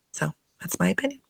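breathy whisper of a verdict; whispered aside. Real, not acted. Vlogger whispering her opinion to viewers, hushed whisper. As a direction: drop to a whisper for the last word.

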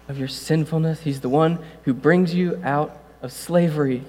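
ordinary delivery

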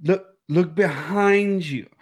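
high, drawn-out movie warning call; Randy. Acted. Host showing off an impression to co-host, slow high warning. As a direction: stretch out "behind" in a high voice. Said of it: "It's my Randy impression".

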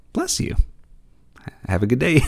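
earnest high pet-talk blessing; sincere to the dog. Retold or imitated. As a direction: bless the dog like you truly mean it. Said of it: "I legit wanted this dog to know".

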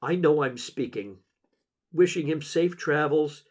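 ordinary delivery

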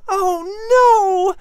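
very high, loud wail of alarm; dismayed cry. A narrator's reading. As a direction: cry out as your friend falls. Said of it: "Patrick cried".

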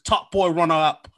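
hammed-up contest host; mock award announcer. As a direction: proclaim the winner to the room. Pretend Top Boy award announcement.

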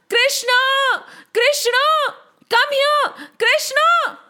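high, urgent calling out; shouting for Krishna. A narrator's reading. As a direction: call a friend from afar, pitched high. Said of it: "why are you shouting?".

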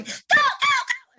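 hyper excited cheering. Retold or imitated. Trainer imitates hyper handler hyping an energetic agility dog. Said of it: "And they're going".

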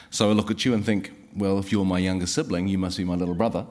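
usual speaking voice

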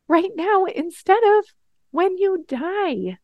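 impassioned climax of the refrain; building emphasis. Acted. Refrain climaxing, pleading intensity.

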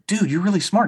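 high-pitched mock amazement; impressed dad. Dad praising his young son, impressed.

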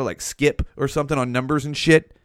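ordinary delivery

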